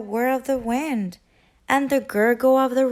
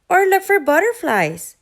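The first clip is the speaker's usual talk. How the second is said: cheerful quack; 7 semitones higher, 5 dB louder than usual. A narrator's reading. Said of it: "quacked Duck".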